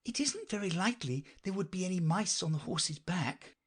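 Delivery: high, politely doubtful listener; Alice. Acted. Alice doubts there are mice on horseback, high polite voice. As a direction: raise the pitch and reason politely.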